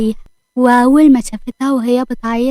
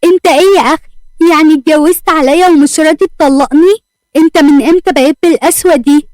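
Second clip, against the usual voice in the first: higher, louder furious outburst; angry shout; 4 semitones higher, 5 dB louder than usual. A narrator's reading. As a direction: yell at him in betrayed fury. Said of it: "أسماء بعصبية وصوت عالي". (زوجة بتزعق في جوزها بعصبية وصوت عالي)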